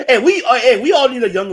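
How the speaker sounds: loud, high and laughing, all in; excited laughing shout. Real, not acted. Podcast host cheering along with his co-host, loud and laughing.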